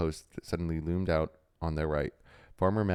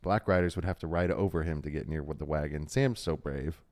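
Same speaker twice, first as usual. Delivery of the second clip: ordinary delivery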